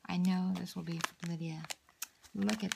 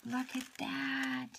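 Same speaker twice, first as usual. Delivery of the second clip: slow, higher, softer "Look at that" in delight; slow, higher, softer marvelling "Look at that"; awed, drawn-out; 5 semitones higher, 3 dB quieter, slower than usual. Real, not acted. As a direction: stretch "Look at that" out in wonder. Admiring the pocket letter.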